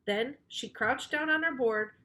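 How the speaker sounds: plain reading voice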